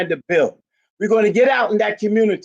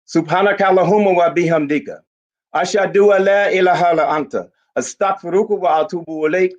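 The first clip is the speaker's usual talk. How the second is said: lower, devout Arabic prayer recitation; recited prayer; 4 semitones lower than usual. Real, not acted. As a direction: recite the closing supplication reverently.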